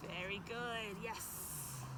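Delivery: normal talking voice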